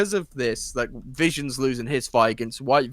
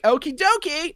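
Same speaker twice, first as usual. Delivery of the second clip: much higher sing-song mock cheer; chirpy Agnes; 13 semitones higher than usual. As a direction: chirp it like a cheerful sitcom neighbour. Agatha turned back into Agnes.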